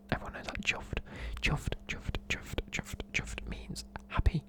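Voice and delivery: usual speaking voice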